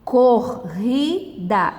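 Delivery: slow "Corrida", cor, ri, da; split into syllables. Shown as a demonstration. Teacher demonstrating syllable division, slowly syllable by syllable. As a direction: say "Corrida" with a gap after each syllable. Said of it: "É assim que separa".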